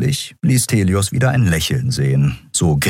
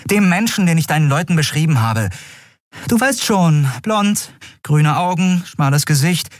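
high-pitched, insistent description; urgent prompting; 10 semitones higher than usual. Acted. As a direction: jog his memory, pitched high. Urgently prompting the admiral's memory. (drängend, mit hoher Stimme)

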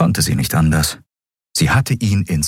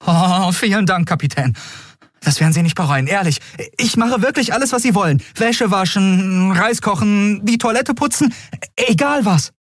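high, gushing thanks; overjoyed boy. Acted. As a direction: babble eager promises. Miko overjoyed. (überglücklicher Junge, hoch und hastig)

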